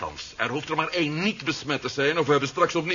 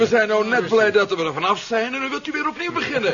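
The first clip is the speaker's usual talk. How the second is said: laughing protest; 5 semitones higher, 6 dB louder than usual. Acted. Amused protest at new plan.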